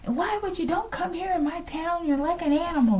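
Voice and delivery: playing the guy scolding the Russian, high and indignant; high-pitched outraged guy. Teller imitates the scolded guy, high and indignant. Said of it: "the guy was like".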